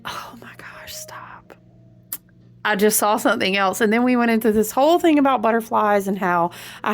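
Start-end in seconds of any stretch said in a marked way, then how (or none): 0.0-1.5 breathy startled whisper; hushed, stopping herself. Real, not acted. Whispering to herself as she spots something.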